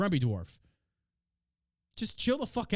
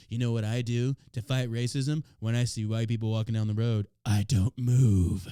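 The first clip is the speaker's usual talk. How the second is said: lightly voiced mock-serious activist; a hint of solemn self-importance; mocking TikToker; 6 semitones lower, 3 dB louder than usual. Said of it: "He's like".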